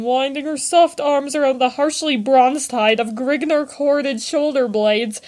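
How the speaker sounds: usual speaking voice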